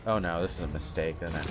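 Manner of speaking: usual speaking voice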